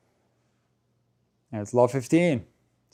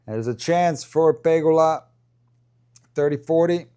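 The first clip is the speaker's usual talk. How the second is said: excited call; 5 semitones higher, 5 dB louder than usual. Real, not acted. Break point in commentary.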